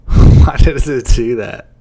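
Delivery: loud, baffled outburst; loud exasperated. Real, not acted. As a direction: blurt it out in disbelief. Software result frustrates her.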